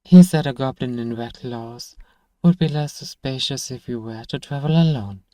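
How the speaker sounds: normal talking voice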